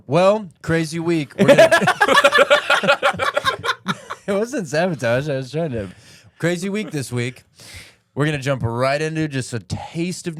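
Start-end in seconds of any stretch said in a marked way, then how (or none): 1.7-4.2 high shrieking laugh. Real, not acted. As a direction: burst out laughing. Loud laugh.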